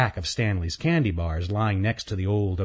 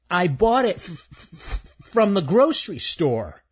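high, stammering squirrel voice; a timid squirrel tripping on words; nervous stuttering squirrel; 10 semitones higher, 4 dB louder than usual. Acted. Stanley's voice.

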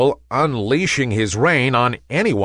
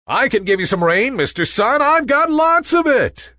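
much higher, fast and eager; eager to help, higher and quick; 13 semitones higher, 3 dB louder than usual. A narrator's reading. Nimbus eager to give rain.

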